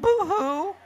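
high-pitched fake boo-hoo; hammed-up storybook crying; mock wailing. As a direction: wail it like a sulking cartoon.

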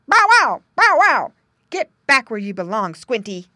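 loud high barks, then a stern order; a dog chasing the pig home; loud barking command. A narrator's reading. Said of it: "so Don barked".

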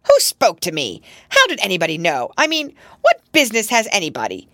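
loud, fast, indignant questions; angry. A narrator's reading. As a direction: fire back angrily, raising the volume. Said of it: "flushing angrily".

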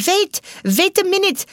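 put-on high, loud call in a German accent; German-accented urgent shout; pronounced German accent. A narrator's reading. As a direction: cry out to halt things with a thick German accent. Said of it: "called one of the actors with a pronounced German accent".

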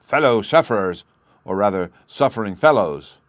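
loud speech-opening address; public oratory. A narrator's reading. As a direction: address a crowd as a pompous orator. Chancellor begins speech to crowd.

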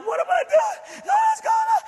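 high, cracking voice through tears; overwhelmed, barely holding together; crying. Real, not acted. Alone in the car, crying to himself in disbelief.

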